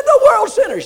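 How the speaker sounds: very high and loud, at the end of her patience; frustrated outburst. Retold or imitated. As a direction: blurt it out in frustration. Woman answering repeated question.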